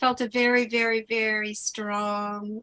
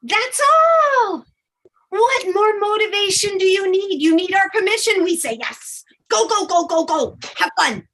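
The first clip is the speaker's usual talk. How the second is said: higher, louder, giddy fairy cheer; cheering fairy guides; 7 semitones higher, 7 dB louder, faster than usual. Acted. Fairy guides urging Leah to take action, loud and giddy. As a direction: hype her up like excited fairy cheerleaders.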